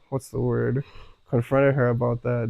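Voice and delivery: ordinary talk, nothing marked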